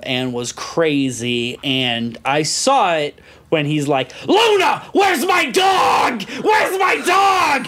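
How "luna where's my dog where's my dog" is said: high, slow, stretched-out shouting; mock frantic yell. Crazy old man looking for Luna, frantic yell. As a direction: bellow for the dog, dragging the words. Re-enacts owner's frantic yelling.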